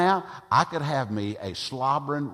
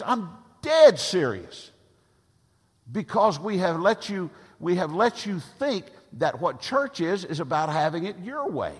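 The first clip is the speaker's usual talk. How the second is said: preaching yell; 6 semitones higher, 4 dB louder than usual. Real, not acted. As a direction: drive it home as if yelling at yourself. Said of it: "I'm yelling at me".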